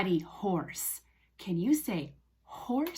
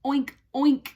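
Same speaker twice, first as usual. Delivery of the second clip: loud, higher oinks; pig oink; 4 semitones higher, 7 dB louder than usual. Shown as a demonstration. Teacher telling children what the pig says, oinking. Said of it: "The pig says".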